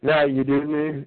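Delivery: usual speaking voice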